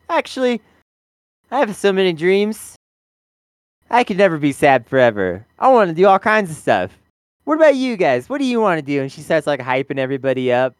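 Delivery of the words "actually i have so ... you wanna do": higher, bright mock-cheerful rallying; upbeat pep talk. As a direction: hype everyone up, imitating Emma. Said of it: "then she's like".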